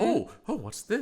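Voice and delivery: high playful oh; mock surprise. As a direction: feign delighted surprise.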